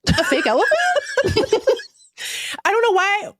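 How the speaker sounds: high-pitched, incredulous laughter; squeaky-high, laughing through the words; laughing disbelief. Real, not acted. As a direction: crack up while protesting the absurdity. Incredulous mockery of commenters, cracking up.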